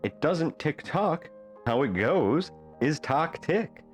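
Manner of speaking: ordinary delivery, nothing marked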